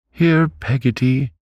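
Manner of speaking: calling out. A narrator's reading. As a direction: call the maid down the passage. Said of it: "cried Miss Betsy".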